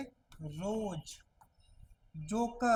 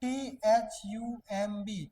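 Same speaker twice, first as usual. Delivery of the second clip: slow spelling; much slower than usual. Shown as a demonstration. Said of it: "जैसे मैं".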